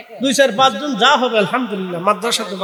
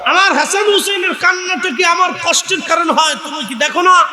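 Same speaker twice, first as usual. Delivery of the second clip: raised, preacherly rebuke in sharp questions; scolding; 8 semitones higher, 3 dB louder than usual. Retold or imitated. Prophet rebuking Fatema for upsetting Hasan and Husain, acted scolding. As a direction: press each reproachful question home, high and forceful. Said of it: "ধমক দিয়ে বললেন".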